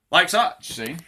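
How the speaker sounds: normal talking voice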